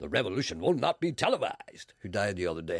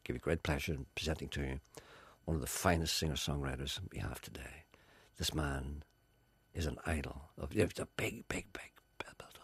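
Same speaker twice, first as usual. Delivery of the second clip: hushed presenter voice; 6 semitones lower, 7 dB quieter than usual. Retold or imitated. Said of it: "Jerry comes up to the camera and he says".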